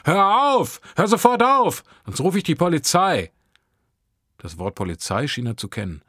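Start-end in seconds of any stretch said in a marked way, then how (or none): 0.1-3.3 high, loud yell, easing slightly on the threat; yelling over din. A narrator's reading. Teen threatens boy over bin clatter, yelling. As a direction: bellow the order over clattering bins. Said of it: "um das Tonnengeschepper niederzubrüllen".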